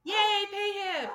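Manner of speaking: high, loud happy cheer; excited cheer. Real, not acted. Cheering the platform name.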